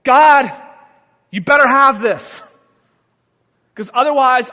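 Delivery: loud, pushy plea to God; loud nervous ultimatum to God; mock demanding prayer. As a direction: demand it of God, half joking. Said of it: "I'd have been like this".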